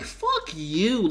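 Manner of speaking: high, slow, laughing fuck you; laughing exasperation. Real, not acted. A fan venting at the characters, slow laughing scorn.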